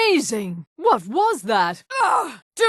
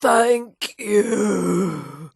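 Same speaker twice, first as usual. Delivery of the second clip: freed spirit's relief; 3 semitones lower, much slower than usual. Acted.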